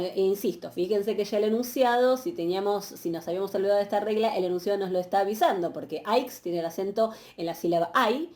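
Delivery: ordinary talk, nothing marked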